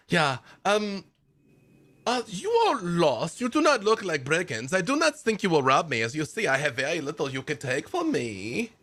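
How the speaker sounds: chatty eccentric woodsman, pleased with himself; lightly German-accented cheerful stranger; Albrecht the nudist. Acted. GM voices Albrecht NPC.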